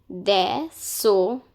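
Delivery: désolé, slowed down syllable by syllable; slow teaching demo. Shown as a demonstration. Teacher slows désolé down for students. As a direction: spell désolé out slowly for learners. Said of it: "Now this is pronounced as lé".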